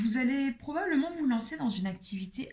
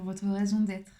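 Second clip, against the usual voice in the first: ordinary delivery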